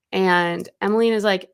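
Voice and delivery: ordinary talk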